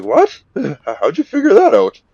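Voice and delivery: high, loud startled squawk; shocked. Retold or imitated. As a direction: blurt it out in disbelief. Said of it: "And he's like".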